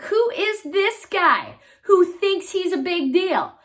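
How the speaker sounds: high, indignant question; scornful David. A narrator's reading. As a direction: scoff at the giant's boast.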